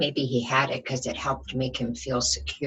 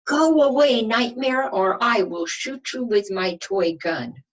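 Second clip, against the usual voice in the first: put-on much higher and louder childlike threat; brave threat; 9 semitones higher, 7 dB louder than usual. Little boy scaring off his nightmare, high loud brave shout.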